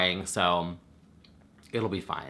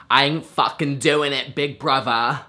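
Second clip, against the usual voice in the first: higher, louder brash reality star voice; Gemma Collins; 5 semitones higher, 5 dB louder than usual. Retold or imitated. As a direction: belt it out like a fed-up reality star. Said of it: "Gemma Collins".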